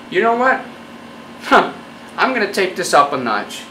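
ordinary talk, nothing marked

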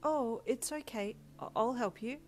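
high, kindly reassurance; bright reply. A narrator's reading. Midoriya comforting Todoroki, brightly. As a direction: reassure brightly, without raising volume. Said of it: "Midoriya replied brightly".

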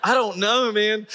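high-pitched helpless protest; helpless bewilderment. Retold or imitated. Man answering Jessica's scolding in the car, helpless. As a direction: throw it back high, at a total loss.

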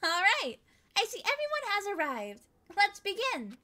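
high, bubbly magical mascot teacher; sweet storybook teacher voice; fantastical voice. Acted. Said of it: "voice sounded, uh, like, fantastical".

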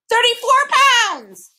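very high, drawn-out bird shriek; loud, slow, piercing squawk. A narrator's reading. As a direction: screech it while flying away. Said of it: "shrieked the bird".

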